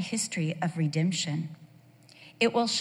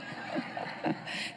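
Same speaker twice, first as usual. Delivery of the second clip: soft breathy laugh; 12 dB quieter than usual. Real, not acted. Laughs at her own story.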